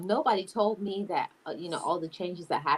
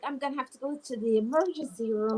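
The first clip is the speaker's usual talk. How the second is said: high-pitched mock panic; hammed-up alarm, voice pitched up; retold freak-out; 7 semitones higher than usual. Retelling her first period, play-acted freaking out. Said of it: "I was, like, freaking out".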